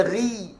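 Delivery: ri thinned, tongue root not lifted; wrong, isti'la dropped. Shown as a demonstration. Teacher shows the faulty reading, thin demo. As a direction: say ri with no thickness at all. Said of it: "Menggugurkan sifat huruf is".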